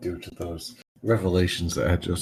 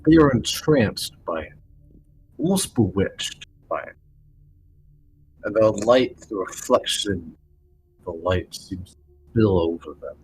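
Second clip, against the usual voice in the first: slow, hushed storyteller voice; scene setting. Acted. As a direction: describe it slowly with awe. Game master describes crowd.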